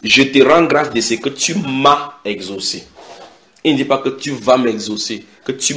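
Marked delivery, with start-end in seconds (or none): 0.0-2.9 raised, slow thanksgiving; solemn, projected prayer; loud prayer to the Father. Acted. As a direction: call up to heaven, then slow down. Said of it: "leva les yeux en haut et dit".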